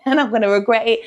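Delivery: ordinary talk, nothing marked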